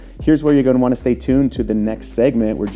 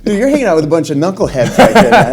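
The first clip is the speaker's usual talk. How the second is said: laughing; 6 semitones higher, 9 dB louder, faster than usual. Real, not acted. Host cracks up at guest.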